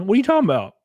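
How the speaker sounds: high-pitched mock protest; John Maylin. Streamer jokingly denies talking like a fellow streamer, high mocking impression. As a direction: put on a high, incredulous voice. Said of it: "Why are you talking like John Maylin?".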